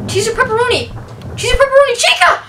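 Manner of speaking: rising high panicked cries; frantic as the monster shows up. Real, not acted. Animatronic appears during pizza choice.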